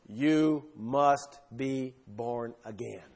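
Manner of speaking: slow, hushed and solemn; quoting Jesus solemnly. Retold or imitated. Preacher quoting Jesus to the congregation, slow and hushed. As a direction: let the words of Jesus settle slowly. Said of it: "You know what Jesus said?".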